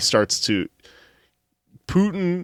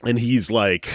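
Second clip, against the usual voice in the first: usual speaking voice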